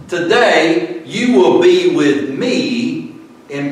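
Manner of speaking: today drawn out slowly and leaned on; stress on today. Shown as a demonstration. Preacher reading to the congregation, slow stress on today. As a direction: lean on the word today, slow and weighty. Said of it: "Emphasis on today".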